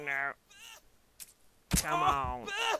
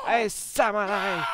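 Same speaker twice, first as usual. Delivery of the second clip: slow loud shout across the room; drawn-out hail; calling out; 5 semitones higher, 10 dB louder, slower than usual. Real, not acted. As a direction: yell to get the samurai's attention. Calling out to samurai.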